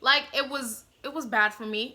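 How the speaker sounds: ordinary delivery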